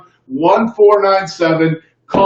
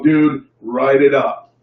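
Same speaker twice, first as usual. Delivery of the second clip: slow, low dude close; 5 semitones lower, slower than usual. Shown as a demonstration. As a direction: drawl it calmly and slowly. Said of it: "you put your hand out slowly and you go".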